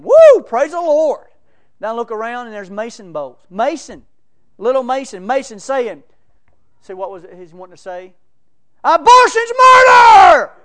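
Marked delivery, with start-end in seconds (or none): 0.0-1.3 loud, slow, drawn-out cry of praise; joyful shout. Retold or imitated. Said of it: "I'm sitting there, I'm going". 8.8-10.5 very high, very loud, slow yell; loud street-preaching shout. Retold or imitated. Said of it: "Real loud".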